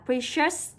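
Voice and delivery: "precious" with a clear sh in the middle. Shown as a demonstration. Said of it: "âm này là âm sh". (đọc "precious" rõ âm sh)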